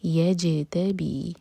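یجتبی slow, ج bounced; bounced jeem. Shown as a demonstration. Teacher models qalqala on jeem slowly. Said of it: "یہاں پر جیم bounce ہوگا".

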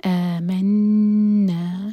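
آمنا with آ stretched two beats, نا held with ghunna; very slow, loud recited آمنا; drawn-out chant; two-beat madd. Shown as a demonstration. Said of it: "آ دو حرکہ".

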